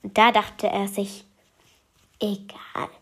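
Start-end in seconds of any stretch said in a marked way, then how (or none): 2.2-2.9 whispered private thought. Acted. The boy whispers his thought to himself. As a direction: breathe it to yourself.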